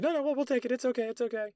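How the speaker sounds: very high, hurried-to-help female voices; high-pitched mock girly reassurance; placating girls. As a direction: imitate embarrassed friends smoothing things over. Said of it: "the girls I'm with are like".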